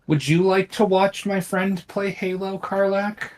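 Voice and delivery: high, bright pet-talk voice. Real, not acted. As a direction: ask the cat sweetly. Asking the cat directly.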